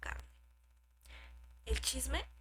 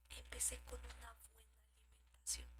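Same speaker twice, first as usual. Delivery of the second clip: hushed whisper; 17 dB quieter than usual. Acted. Personal story whispered.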